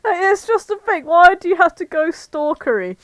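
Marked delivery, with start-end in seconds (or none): none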